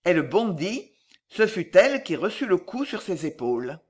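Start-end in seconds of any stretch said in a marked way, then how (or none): none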